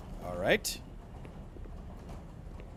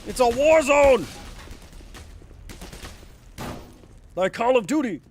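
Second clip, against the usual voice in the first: excited shouting; 10 semitones higher, 8 dB louder than usual. Real, not acted. Frantic firefight while playing.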